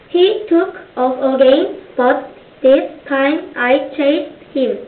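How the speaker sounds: ordinary delivery, nothing marked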